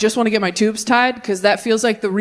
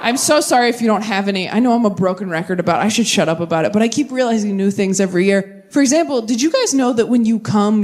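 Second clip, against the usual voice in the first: usual speaking voice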